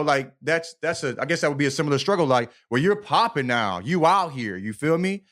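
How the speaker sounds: ordinary delivery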